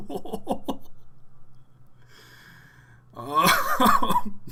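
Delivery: breaking into laughter; laughing. Real, not acted. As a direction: giggle through the um. Laughing after deafening viewers.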